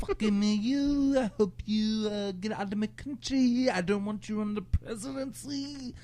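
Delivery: high mock Turkish accent. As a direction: play the Turkish protester, badly. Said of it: "I don't know how to do a Turkish voice".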